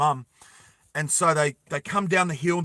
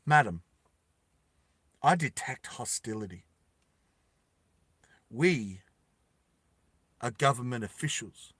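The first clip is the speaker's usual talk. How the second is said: official; 3 semitones lower, 5 dB quieter than usual. Acted. Official drone voice held.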